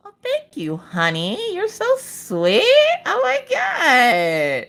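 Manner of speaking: high, slow sugary thank-you; mock-adoring husband; sweet mock husband. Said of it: "he's like".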